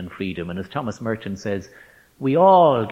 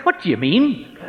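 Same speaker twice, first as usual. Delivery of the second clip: high, loud Northern Irish comeback; indignant Northern Irish accent; 11 semitones higher, 6 dB louder than usual. Retold or imitated. Student taken aback by the teacher's refusal, indignant Northern Irish accent. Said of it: "blurted out in his north of Ireland accent".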